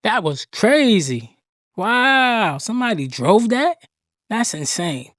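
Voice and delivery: loud, stunned exclamation; amazed outburst. Real, not acted. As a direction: blurt it out in disbelief. Reacting to a stunt clip.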